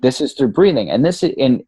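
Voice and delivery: ordinary talk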